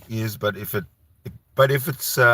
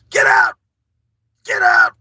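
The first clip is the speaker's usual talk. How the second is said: loud, high shouted order; imitating yelling; 10 dB louder than usual. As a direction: yell it down the stairs like Jason. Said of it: "was, uh, yelling at, uh, at Shani from upstairs".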